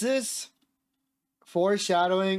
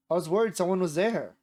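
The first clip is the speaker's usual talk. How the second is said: ordinary talk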